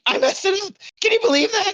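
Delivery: high-pitched, incredulous outburst at a flub; playful high-pitched exasperation; mock disbelief. Real, not acted. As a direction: exclaim it high, as if you can't believe you botched it. Mock outrage at own flub.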